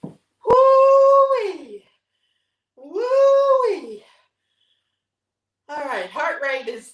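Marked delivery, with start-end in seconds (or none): 0.0-4.0 long, loud, high woo-wee; woo-wee cheer. Real, not acted. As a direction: holler it out, spent but happy. Exhausted cheer after workout.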